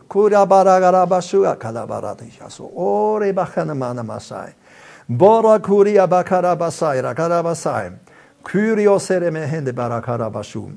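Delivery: fluent, flowing glossolalia; praying in tongues. Real, not acted. Preacher praying in tongues before the congregation, flowing and fervent. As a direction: let the tongues pour out steadily.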